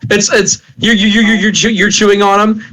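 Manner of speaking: ordinary talk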